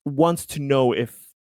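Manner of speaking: normal talking voice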